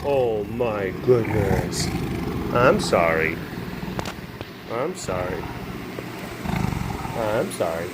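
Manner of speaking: low, slow, gentle sorry; soft apology. Real, not acted. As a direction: soothe the pet with a quiet apology. Apologizing softly to the animal.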